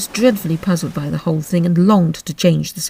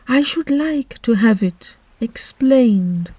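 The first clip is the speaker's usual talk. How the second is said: slow, mournful turtle voice; Mock Turtle voice; 6 semitones higher, slower than usual. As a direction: drag it out like a sad old turtle.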